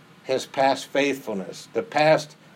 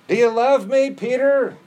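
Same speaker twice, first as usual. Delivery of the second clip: high, loud, pleading question; lifted, insistent do you love me; Jesus asking; 11 semitones higher, 5 dB louder than usual. Acted. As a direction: ask it high and searching, as Jesus to Peter.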